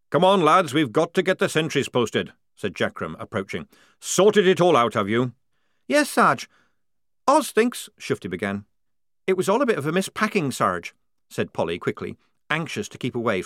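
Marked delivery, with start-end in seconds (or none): 0.1-2.3 loud barking drill sergeant; booming sergeant. Acted. Jackram voiced. 4.0-5.3 loud barking drill sergeant; booming sergeant. Acted. Jackram voiced. 5.9-7.9 put-on high, loud worried girl's voice; anxious young woman. Shufti voiced. 9.3-10.9 fast, high nervous cover; quickly. Acted. Polly quickly.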